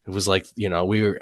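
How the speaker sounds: ordinary delivery, nothing marked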